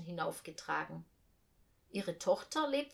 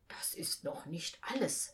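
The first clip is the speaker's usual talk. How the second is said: hushed, secretive whisper; mysterious whisper; 4 semitones lower, 4 dB quieter than usual. A narrator's reading. Uncle Jupp hints at his secret plan, whispering. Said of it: "sagte er geheimnisvoll".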